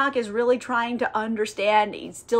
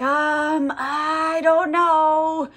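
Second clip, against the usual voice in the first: higher, very drawn-out unsure voice; clueless winging it; 4 semitones higher, much slower than usual. Retold or imitated. Angel winging it picking souls, drawn-out hesitation. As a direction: stretch it out like someone guessing. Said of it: "he's just winging it".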